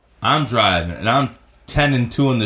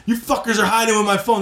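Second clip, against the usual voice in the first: put-on drunk demand; 8 semitones higher than usual. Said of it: "And I'm like".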